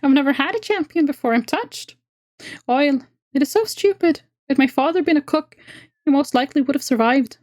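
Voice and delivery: squeaky creature snapping orders. Acted. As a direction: squeak it out, busy and curt. Voices the elf woman.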